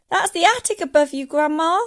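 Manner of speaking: put-on high, quick, loud boy; calling out. A narrator's reading. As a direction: shout a warning upward. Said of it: "George called out".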